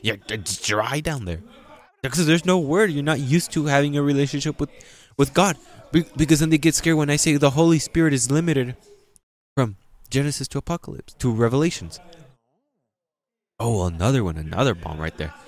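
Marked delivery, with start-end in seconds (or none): 2.0-5.6 high, loud preaching; preaching at full force. Acted. Preacher raising voice. 5.9-12.0 high, loud preaching; preaching at full force. Acted. Preaching climax.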